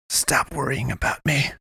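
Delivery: a touch of a snarl, kept soft; mild snarl. A narrator's reading. Wounded woman snarling at him to stop fussing. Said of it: "she snarled".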